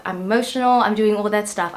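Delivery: ordinary delivery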